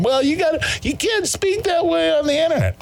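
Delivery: high squeaky mock of the scolding critics; mocking quote. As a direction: mimic the woke critics in a high nagging voice. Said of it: "tell him".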